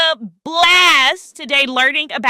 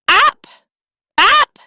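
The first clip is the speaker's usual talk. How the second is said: rising higher; 8 semitones higher, 5 dB louder than usual. Shown as a demonstration. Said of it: "Let's stretch up high".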